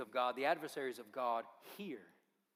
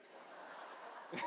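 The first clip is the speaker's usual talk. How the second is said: real laugh; 7 dB quieter than usual. Real, not acted. Preacher laughs at the sidekick.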